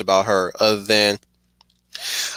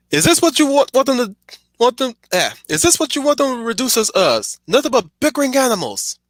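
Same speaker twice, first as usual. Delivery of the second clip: Lucian impression; 12 semitones higher than usual. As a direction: roar it at the bickering Lycans. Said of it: "I'll try it again".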